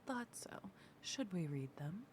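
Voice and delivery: hushed conspiratorial whisper. Acted. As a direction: whisper it like a sneaky rogue. Rogue whispers in character.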